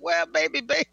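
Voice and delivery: imitating own coaxing apology; coaxing retold quote. Retells softening after blah-blah outburst.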